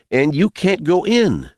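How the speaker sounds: put-on high-pitched insistent boy; boy's voice. A narrator's reading. Boy forbidding man to enter, boyish warning. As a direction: warn in a high young voice.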